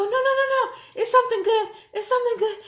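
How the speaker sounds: high, loud, laughing and half-crying; breathless joy. Retold or imitated. Reassuring her frightened daughter, breathless, laughing, tearful. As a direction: gasp it out between laughs and tears. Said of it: "I was trying to catch my breath".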